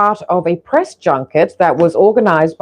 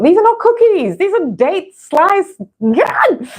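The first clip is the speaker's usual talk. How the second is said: much higher, real delighted outburst; ecstatic exclamation; 14 semitones higher, slower than usual. Real, not acted. Speaker marvels over the date slices mid-bite, ecstatic high outburst. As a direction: squeal it high, overwhelmed by the taste. Raving while tasting the treats.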